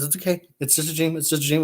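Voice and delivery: calm her down gently; soothing. Retold or imitated. Boyfriend soothing his girlfriend back to sleep, softly. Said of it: "He goes".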